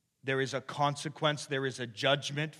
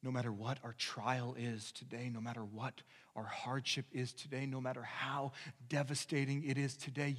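usual speaking voice

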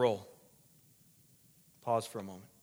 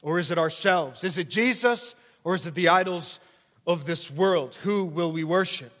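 loud, high preacher's call; fiery preaching; 6 semitones higher, 8 dB louder than usual. Acted. Preacher challenging the church, at full force. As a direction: thunder the question at the congregation.

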